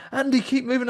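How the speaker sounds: hint of impatient friends; mock group urging. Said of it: "five other people going".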